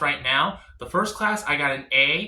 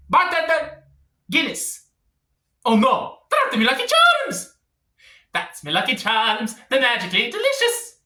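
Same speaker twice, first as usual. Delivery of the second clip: hammy Irish leprechaun voice, much higher; Irish accent; 6 semitones higher than usual. Pretend. Claiming Irish blood, he orders a Guinness in a hammy Irish accent. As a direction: play a cereal-box leprechaun in a thick Irish accent.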